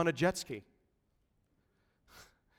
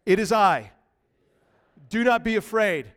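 ordinary talk, nothing marked

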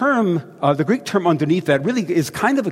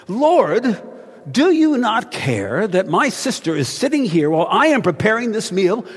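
indignant demand; 6 semitones higher than usual. Retold or imitated. Martha demanding Jesus send Mary to help, indignant. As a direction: demand that he send her sister. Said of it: "comes up and says".

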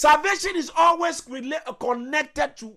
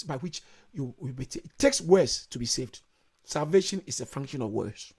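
much lower and softer, intimate; hushed; 9 semitones lower, 8 dB quieter than usual. Real, not acted. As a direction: drop to a near hush. Sudden hush after climax.

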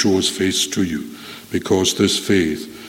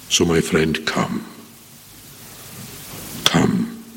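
soft invitation; 4 semitones lower, 8 dB quieter, slower than usual. Acted. Preacher's quiet invitation.

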